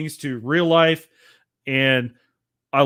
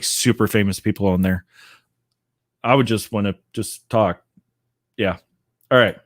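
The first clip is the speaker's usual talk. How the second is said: usual speaking voice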